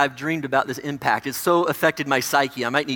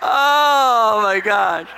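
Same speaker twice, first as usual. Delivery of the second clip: laughing; 4 semitones higher, 6 dB louder, much slower than usual. Real, not acted. Pastor cracks up at own slip.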